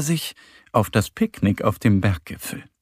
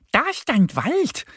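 high duck voice calling out; rufende kleine Ente; 12 semitones higher than usual. A narrator's reading. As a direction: point it out in a high, eager call. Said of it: "ruft Annie". (hoch gerufen, als kleine Ente)

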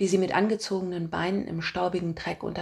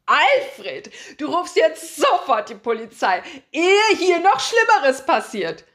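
put-on much higher, loud, slow commanding old lady; bossy old woman; 10 semitones higher, 7 dB louder, slower than usual. A narrator's reading. Old woman shrilly commands her husband to call police. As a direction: order your husband about in alarm.

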